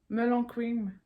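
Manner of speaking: ordinary talk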